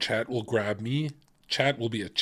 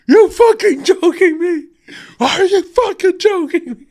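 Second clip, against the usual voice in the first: very high, much louder laughing yell; mock outraged shout; 11 dB louder than usual. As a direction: scream it in hammed-up disbelief. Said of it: "I'm gonna be like".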